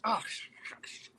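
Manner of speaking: retold frustrated sigh of words; mad at himself. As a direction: voice dropping to a soft curse. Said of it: "He was so mad at himself".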